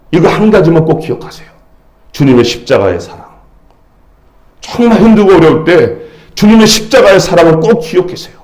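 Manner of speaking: ordinary talk, nothing marked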